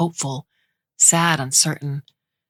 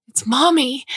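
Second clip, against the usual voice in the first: put-on high, bright, louder child's cry of joy; excited child; 9 semitones higher, 4 dB louder than usual. A narrator's reading. Older sister telling her sister mom is back, excited high cry. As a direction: shout it like a thrilled little girl.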